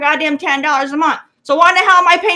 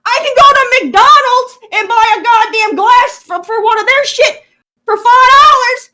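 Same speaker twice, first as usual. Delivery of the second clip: furious shout; 9 semitones higher, 7 dB louder than usual. Real, not acted. Ranting at cheap glassware.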